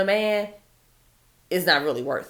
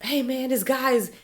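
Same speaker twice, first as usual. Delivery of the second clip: higher, put-on pleading girl voice; imitating a scared girl; 7 semitones higher than usual. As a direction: play the girl begging for help. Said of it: "she says".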